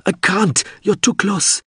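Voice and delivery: very high, panicky protest; frantic cry. A narrator's reading. Pilot tells crewman he is too close, frantic high cry. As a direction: plead helplessly, pitched right up. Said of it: "Mip cried".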